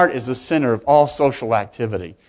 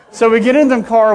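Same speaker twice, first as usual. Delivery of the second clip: laughing; 8 semitones higher, 7 dB louder than usual. Real, not acted. Laugh heard on line.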